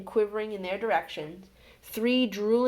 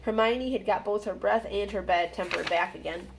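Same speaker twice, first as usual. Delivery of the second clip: ordinary delivery, nothing marked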